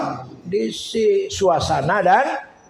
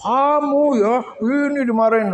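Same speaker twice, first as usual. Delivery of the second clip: high, raised scolding voice; mock angry scolding; 7 semitones higher, 3 dB louder than usual. Village grandpa chasing the boys who teased him, mock angry. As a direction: imitate an irate elder yelling after children. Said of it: "Dia kalau marah lama".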